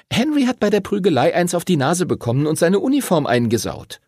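put-on high-pitched girl covering for friends; supply the excuse in a high child's voice; Lucy, helpful girl. Lucy backs Arthur's excuse to teacher, high girl's voice.

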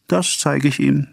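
high-pitched, insistent threat; worked-up repeated threat. A narrator's reading. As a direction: push it out high, as a vow. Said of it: "immer wieder".

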